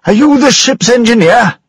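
high, loud shout over the storm; Daniel calling out over the roar. Acted. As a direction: yell it across the noisy engine room. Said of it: "shouted to him".

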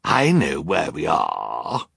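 slow, drawn-out villain's sneer. A narrator's reading. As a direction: sneer it with smug superiority. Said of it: "Olaf sneered".

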